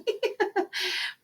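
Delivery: high breathy giggle she can't hold back; cracking up at her own slip mid-lesson; giggle at herself. Real, not acted. Teacher giggling at herself during a math video. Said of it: "I just made myself laugh".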